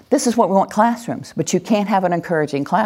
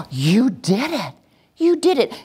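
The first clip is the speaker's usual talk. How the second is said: slow delighted praise rising higher; celebrating; 4 semitones higher than usual. Shown as a demonstration. Teacher celebrating listeners who followed the command, delighted praise. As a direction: marvel at their success, stretching the words. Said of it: "we want to celebrate them".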